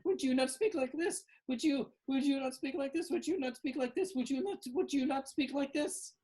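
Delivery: very high, repetitive creature voice; chattering hatchling creatures. Acted. Hatched Winky Wallies echo one another, high insistent chatter. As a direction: loop the question in a squeaky high voice.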